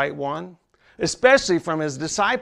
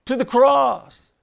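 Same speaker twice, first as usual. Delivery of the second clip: higher, slow and emphatic; climbing preaching; 7 semitones higher, 4 dB louder, slower than usual. Acted. Preacher drives toward the cross for the congregation, slow and rising. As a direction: lift it to the cross.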